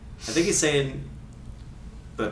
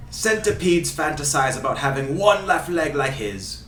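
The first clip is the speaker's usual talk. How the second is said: put-on Ray Hudson Scottish accent. Host reading a free kick quote, in fake Scottish accent. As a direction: fake Scottish commentator, theatrical. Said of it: "doing his accent".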